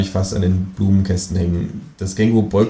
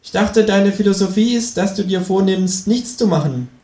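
kangaroo voice; 12 semitones higher than usual. Acted. The kangaroo speaks.

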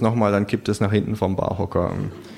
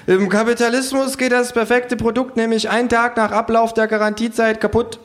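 kangaroo voice; 14 semitones higher than usual. Acted. Narrator voicing the kangaroo, high and cheeky. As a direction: pitch up high for the sarcastic kangaroo.

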